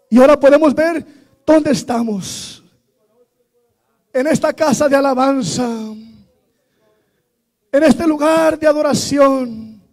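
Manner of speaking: impassioned prayer over music, swelling loud; fervent prayer. Real, not acted. Pastor praying at the open altar with the church, fervent. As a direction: pour it out to God with rising fervor.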